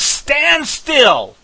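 high, loud "Stand still"; commanding shout. Acted. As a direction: shout the order to the frightened crowd. Moses commanding the people.

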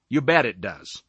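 ordinary delivery

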